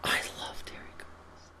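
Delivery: hushed, breathy whisper; barely voiced aside. Real, not acted. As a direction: whisper it like a confession. Aside whispered about favourite show.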